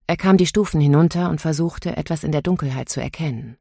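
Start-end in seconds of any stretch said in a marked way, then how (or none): none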